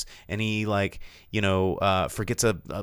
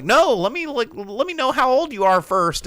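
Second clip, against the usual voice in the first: high, loud, urgent refusal; mock insistence; 6 dB louder than usual. Undercover cop stops the woman, loud mock insistence. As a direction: act out a cop cutting her off.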